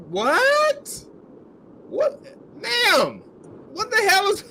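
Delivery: high disbelieving what; shocked, can't believe it; incredulous. Real, not acted. Incredulous reaction, much higher.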